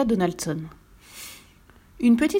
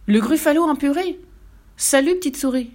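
higher, louder frightened monster; panicked; 7 semitones higher, 4 dB louder than usual. A narrator's reading. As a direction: blurt it and run. Monster flees the mouse.

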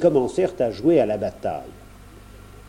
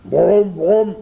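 loud, higher-pitched bear growl; 7 semitones higher, 7 dB louder, slower than usual. A narrator's reading. Bear stops the boy in the forest, growling. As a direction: growl a challenge like a storybook bear. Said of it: "grogna l'ours".

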